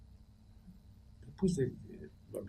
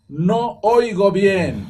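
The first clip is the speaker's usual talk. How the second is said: loud, high, very slow "No oigo bien"; correcting the grammar loud and slow; emphatic correction; 10 semitones higher, 14 dB louder, much slower than usual. Shown as a demonstration. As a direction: spell out the correct form slowly.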